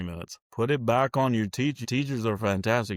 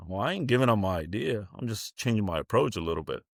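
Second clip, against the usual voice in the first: usual speaking voice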